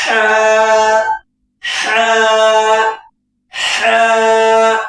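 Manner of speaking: ہا pushed from the throat. Shown as a demonstration. Teacher models a throaty ہا for students. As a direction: breathe ہا out from the throat. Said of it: "اس طریقے سے آپ نکالیے اس کو".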